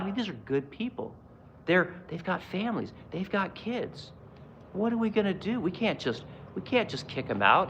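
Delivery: soft, mock-tender pleading; mock sympathetic plea. Mocking bleeding-heart people, soft pleading voice. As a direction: parody a bleeding heart, softly.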